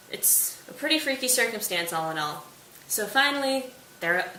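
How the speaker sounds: ordinary delivery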